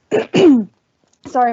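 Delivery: hoarse, strained voice; losing voice. Real, not acted. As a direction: voice going hoarse. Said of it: "I'm losing my voice".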